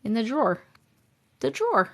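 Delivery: "drawer" as one syllable, "draw-r"; rounded "aw" in "drawer"; New York pronunciation. Shown as a demonstration. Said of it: "I can't say drawer".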